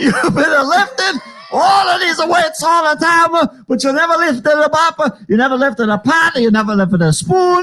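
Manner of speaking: high, ranting cartoon Italian; hammed-up Italian parent complaint; mock Italian accent. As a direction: nag in a bad Italian accent. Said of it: "why we can't do the accent".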